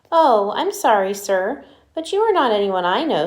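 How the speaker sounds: prim giraffe turning him away. Acted. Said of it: "said Miss Giraffe".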